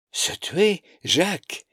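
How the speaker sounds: high mocking disbelief; incredulous. A narrator's reading. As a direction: echo it, amused and doubtful. Sister echoes in disbelief.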